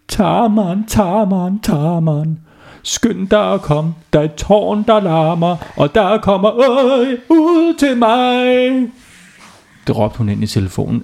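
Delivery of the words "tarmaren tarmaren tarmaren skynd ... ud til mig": calling Tarmaren down the phone, a bit higher; urgent phone call, high and eager; mock shout. A narrator's reading. Ulla calling Tarmaren on the phone, mock shout. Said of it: "og Ulla råbte".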